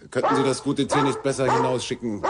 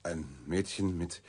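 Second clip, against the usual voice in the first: softer, halting and dumbfounded; barely able to repeat it; stunned; 3 semitones lower, 10 dB quieter than usual. Acted. Reporter repeating her shocking words, stunned and faltering.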